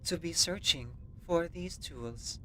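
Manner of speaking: put-on friendly android with a German accent; German robot android. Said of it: "A German robot android, but friendly".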